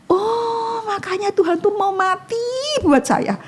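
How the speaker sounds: high, breaking into tears; overwhelmed by gratitude; real crying while testifying; moved to tears. Real, not acted. Moved, testimony of God's love.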